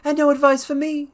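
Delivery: high, loud, voice cracking with fear; a desperate cry, voice breaking. Acted. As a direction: plead as if about to break. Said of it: "cried Blessington in a breaking voice".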